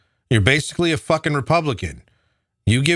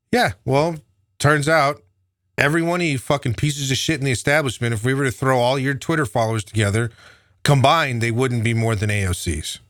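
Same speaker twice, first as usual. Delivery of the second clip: usual speaking voice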